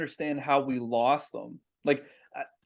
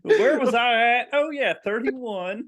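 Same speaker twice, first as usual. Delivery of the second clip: mock confused counter, higher and slow; lost crab counter; 6 semitones higher, 5 dB louder, slower than usual. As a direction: play the hapless counter losing his place, slowly. Cletus counting crabs, losing track.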